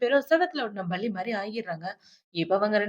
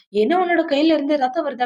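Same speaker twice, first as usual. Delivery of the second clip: louder, higher startled question; mock alarm, pitched up; retold shock; 5 semitones higher, 7 dB louder than usual. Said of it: "hero shock ஆயிடுறான்". (அதிர்ச்சியோடு கேட்பது போல)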